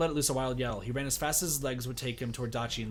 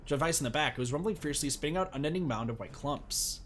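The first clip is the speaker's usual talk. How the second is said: normal talking voice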